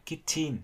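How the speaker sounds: KITCHEN as two full syllables, "kit-chin"; weight both halves of KITCHEN evenly, Vietnamese style. Shown as a demonstration. Teacher showing what people don't say, KITCHEN in even syllables. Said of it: "chứ người ta không nói".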